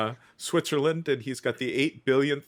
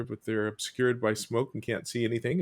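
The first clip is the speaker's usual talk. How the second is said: ordinary delivery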